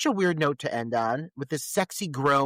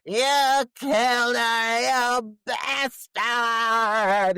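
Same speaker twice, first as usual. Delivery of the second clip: high, drawn-out movie-heroine shout; hammed-up vengeful scream, every word stretched; badass defiant yell; 9 semitones higher, 5 dB louder, much slower than usual. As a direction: spit it out slowly and loudly, teeth gritted. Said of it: "gritting her teeth, really looking like a, a badass".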